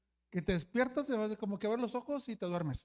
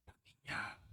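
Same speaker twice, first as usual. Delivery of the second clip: a toneless ghostly whisper, much softer; eerie whisper; 12 dB quieter than usual. Retold or imitated. Sleepwalking boy whispering to the sleeping group. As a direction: breathe it out like someone talking in his sleep. Sleepwalking youth mutters eerily.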